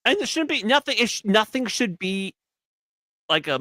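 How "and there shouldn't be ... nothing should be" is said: heated protest. Real, not acted. Arguing back at S1.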